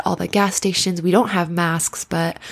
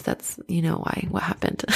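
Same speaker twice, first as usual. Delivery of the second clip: breathy whispered aside, almost secret; 8 dB quieter than usual. Real, not acted. Podcaster whispering an aside to her listeners. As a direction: let it drop to a whisper to the listeners.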